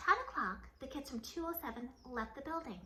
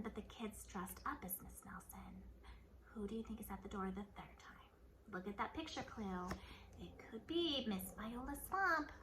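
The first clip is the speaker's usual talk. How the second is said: whisper; 3 semitones lower, 6 dB quieter than usual. Real, not acted. Teacher sharing a secret guess with kids, whispered. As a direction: let them in on a secret. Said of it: "whispers to the listeners".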